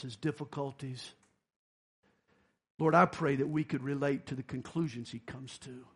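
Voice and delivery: closing prayer voice. Acted. Closing prayer over music.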